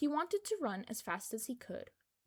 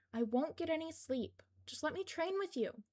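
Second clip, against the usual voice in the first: higher, whiny begging; whiny pleading; 7 semitones higher than usual. A narrator's reading. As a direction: plead like a sulky kid. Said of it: "Izuku whined".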